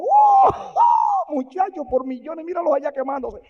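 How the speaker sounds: high, loud crowing guide; gleeful shout. Acted. Hell's guide shouting to Wesley about burning crowds. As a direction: yell it out like a showman.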